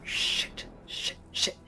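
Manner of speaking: unvoiced panicked curses under the breath; whispered curses. Draconian realises the prisoner may die, whispers curses.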